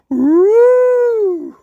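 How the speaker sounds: high, loud teasing ooh from the crowd; loud high mocking ooh; lads' mock ooh. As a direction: hoot it like mates egging a fight on. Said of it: "the lads go".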